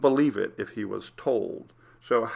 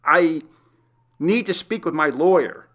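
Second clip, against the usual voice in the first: insistent; 5 semitones higher, 5 dB louder than usual. Acted. Insisting to the guard.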